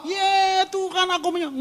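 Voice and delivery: over-the-top worship cry; mock showy worship. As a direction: ham up a worship leader.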